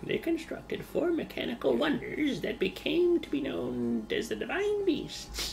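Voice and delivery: high, slow put-on narrator voice; mock narrator voice. Dubbing the game cutscene for a friend, a high slow mock narrator. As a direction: dub the cutscene in a silly high voice.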